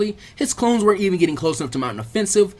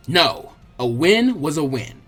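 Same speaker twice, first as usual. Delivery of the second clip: ordinary delivery, nothing marked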